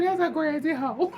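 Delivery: laughing retort. Retold or imitated. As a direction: blurt it out still cracking up. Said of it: "I said".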